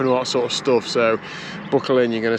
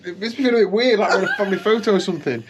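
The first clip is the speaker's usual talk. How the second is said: laughing; 8 semitones higher, faster than usual. Real, not acted. Laughing at the family photo.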